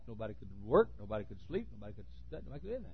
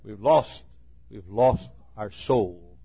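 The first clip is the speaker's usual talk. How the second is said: slow lament; 3 semitones lower, 8 dB louder, much slower than usual. Acted. Preacher laments church.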